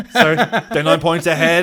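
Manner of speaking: loud, laughing apology. Pretend. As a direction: laugh through the excuse. Laughing mock excuse.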